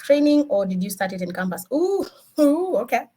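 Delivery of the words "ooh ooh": high, drawn-out double ooh; little high oohs of reaction; surprised ooh. Real, not acted. Reacting to the question.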